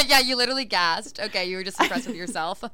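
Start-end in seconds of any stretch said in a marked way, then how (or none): 0.0-1.2 giggling through the words; helpless laughter; laughing hard. Real, not acted. Friends laughing hard at joke.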